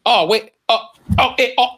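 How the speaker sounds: high panicked mock fumbling; imitating David Cross. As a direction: squeak out confused little oh's. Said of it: "is when he would go".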